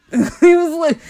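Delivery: laughing through the words, high and loud. Real, not acted. Storyteller amused by the man going back to sleep, laughing. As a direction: crack up while speaking. Cracks up retelling the sleeper's reply.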